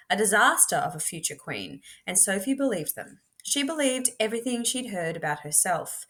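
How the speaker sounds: usual speaking voice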